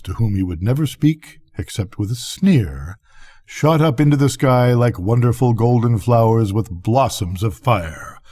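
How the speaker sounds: plain reading voice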